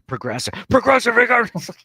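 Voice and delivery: loud, high, escalating ad parody; blaring TV ad. Retold or imitated. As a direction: blare it like an overloud commercial. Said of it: "All the ads, like".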